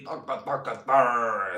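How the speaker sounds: rhythmic mock chant; chanting a mock tune. Mock Mexican music chant.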